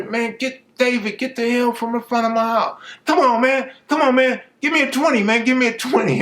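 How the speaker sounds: high, strained back-and-forth; high mock shouting match; quoted argument. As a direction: voice both sides of the street squabble. Arguing with Dave outside house.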